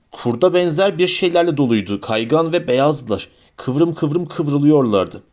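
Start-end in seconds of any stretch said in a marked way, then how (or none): none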